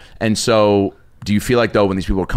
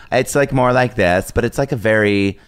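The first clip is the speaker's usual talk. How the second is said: put-on deeper girl voice. Shown as a demonstration. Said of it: "She said, "Do a deeper".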